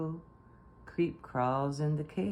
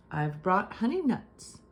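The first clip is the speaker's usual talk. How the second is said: grinning badger; 5 semitones higher, 4 dB louder than usual. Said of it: "Badger says with a grin".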